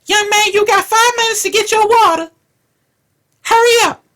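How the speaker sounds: put-on high, loud old schoolteacher barking orders; old teacher scolding. Stand-up comic imitating an old teacher ordering a boy to hurry, high and loud. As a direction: screech at the kid like a strict old teacher. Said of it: "Miss Brown's like".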